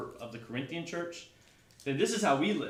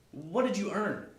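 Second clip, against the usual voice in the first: usual speaking voice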